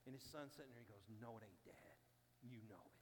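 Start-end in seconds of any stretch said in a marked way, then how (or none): 1.2-3.0 quiet correction. Retold or imitated. Son contradicts his father quietly.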